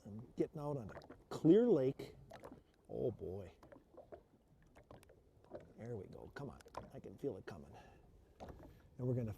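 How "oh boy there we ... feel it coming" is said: hushed. Real, not acted. Waiting for a bite.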